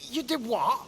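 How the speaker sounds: high, loud disbelieving cry; loud shocked disbelief; shocked outcry. Acted. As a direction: shout it out in horror at what he did. Shocked at freeing evil spirit.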